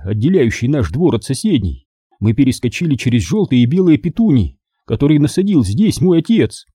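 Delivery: ordinary delivery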